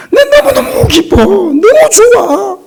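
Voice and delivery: high, tearful burst of joy; sobbing-high joy; overjoyed squeal. Retold or imitated. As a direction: squeal with joy you must hide. Pastor voicing joy he can't share.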